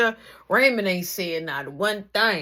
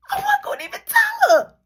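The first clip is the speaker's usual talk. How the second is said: emphatic cry; 8 dB louder than usual. Real, not acted. Emotional emphasis while retelling.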